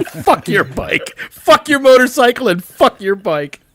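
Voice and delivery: mock biker yell. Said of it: "He's like".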